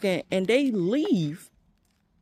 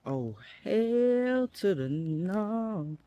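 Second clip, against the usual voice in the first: slow, soft, drawn-out dread; quietly alarmed, stretched out; real alarm; 3 semitones higher, 7 dB quieter, much slower than usual. Real, not acted. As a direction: back away from a loose dog. Spots loose dog, alarmed.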